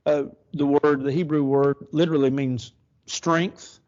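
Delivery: ordinary talk, nothing marked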